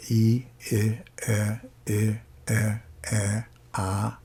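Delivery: vowels slid one into the next; vowel demo. Shown as a demonstration. Teacher demonstrating vowel symmetry to the class. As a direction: glide through the front vowels. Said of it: "For example".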